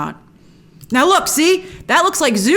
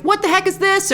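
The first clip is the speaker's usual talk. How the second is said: a higher "What the heck is this?" with a punch on What; mock American take; 9 semitones higher than usual. How an American would react, lightly voiced blunt American. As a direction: play the blunt American version of the line. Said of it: "an American would never say that".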